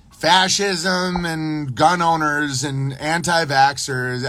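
normal talking voice

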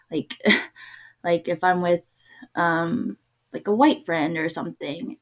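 ordinary delivery

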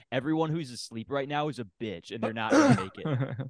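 rising into a loud smug boast. Retold or imitated. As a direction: gloat like you own the morning. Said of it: "I'm thinking, like".

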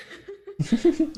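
loud burst of laughter. Real, not acted. Big laugh.

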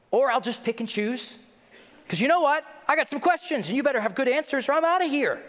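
high, loud brash ultimatum; haughty demand. Acted. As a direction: demand answers with arrogant swagger. Mocking a demanding skeptic.

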